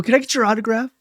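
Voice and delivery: high-pitched fan request; retelling a tentative plea; starstruck asking. Retold or imitated. A starstruck fan asking a celebrity for an autograph, high-pitched.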